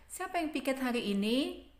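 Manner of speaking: high question with "ini" swung upward; teacher's model question rising on "hari ini". Shown as a demonstration. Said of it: "intonasi di akhir itu akan meninggi".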